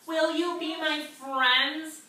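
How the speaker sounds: hopeful little fish. Acted. Lonely fish asks others.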